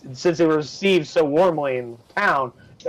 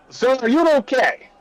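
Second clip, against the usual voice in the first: higher, concerned guard asking; 8 semitones higher than usual. Acted. Gate guard asking the stranger if he is okay, higher and concerned. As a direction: lean in, worried and polite.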